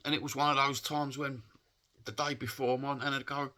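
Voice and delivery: really upset, voice pitched high with emotion. Real, not acted. Man recalling Saint George's Day with nan, upset. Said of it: "it upsets me now".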